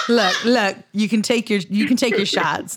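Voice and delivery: usual speaking voice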